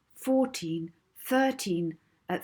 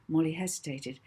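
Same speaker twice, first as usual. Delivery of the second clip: ordinary delivery, nothing marked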